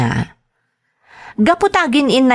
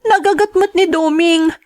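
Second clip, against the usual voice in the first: upset protest; 8 semitones higher than usual. Acted. Daughter protests father insulting Doming.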